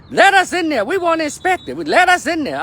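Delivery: high, loud mocking squeal of a congresswoman; mock shrill demand. Mocking a congresswoman demanding entry at the ICE facility, hammed-up shrill. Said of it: "They went to an ice facility".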